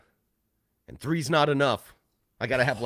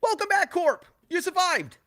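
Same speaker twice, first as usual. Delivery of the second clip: high, loud cheerful welcome back; excited greeting; 4 dB louder than usual. Real, not acted. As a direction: greet him with big excitement. Greeting returning friend loudly.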